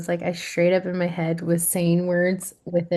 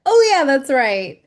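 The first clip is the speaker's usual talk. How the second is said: higher, louder aha moment; sudden recognition; 6 semitones higher, 7 dB louder than usual. Retold or imitated. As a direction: exclaim as if it just came back. Quoting self suddenly remembering.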